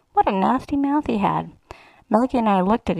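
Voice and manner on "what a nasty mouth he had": plain reading voice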